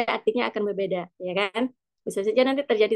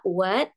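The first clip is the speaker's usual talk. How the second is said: "what" rising like a question; second tone; 2 semitones lower than usual. Shown as a demonstration. Teacher models tone two, "what" as a rising question. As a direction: lift the pitch through "what". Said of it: "nada kedua".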